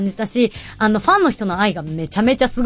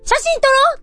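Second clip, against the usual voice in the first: bright invitation. Retold or imitated. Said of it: "って言って".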